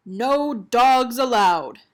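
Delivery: slow, loud, spelled-out notice; stern warning sign. A narrator's reading. Narrator reads the biggest sign as a slow loud warning. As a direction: boom it out like a big sign.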